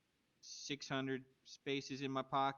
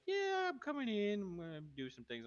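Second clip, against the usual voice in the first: imitating a pushy guest; 10 semitones higher than usual. Retelling banned band people barging in, mock brash voice. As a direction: play the uninvited band guy breezing in. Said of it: "but they're just like".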